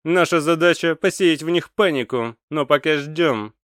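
loud commanding voice; brief the troops firmly; commander's voice. A narrator's reading. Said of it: "сказал командир". (громкий командирский голос)

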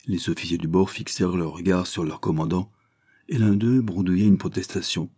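ordinary delivery, nothing marked

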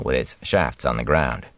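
ordinary delivery, nothing marked

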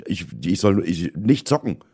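normal talking voice

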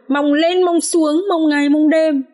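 acted high, aggrieved wail of disappointment; bitter lament. A narrator's reading. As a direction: draw out the long wait reproachfully. Wife bitterly repeats her hopes.

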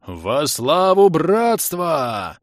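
протяжный боевой клич рыцаря; реветь высоко и громко, растягивая слова; воинственный хриплый рёв. A narrator's reading. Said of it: "Воинственный хриплый рёв потрясал не громкостью". (хрипло проорать боевой клич)

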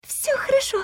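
breathy unvoiced reply; whisper. A narrator's reading. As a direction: whisper it from hiding. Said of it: "шёпотом отозвалась я".

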